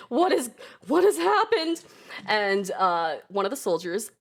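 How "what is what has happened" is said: mock alarm. Monarch reacting to urgent soldiers.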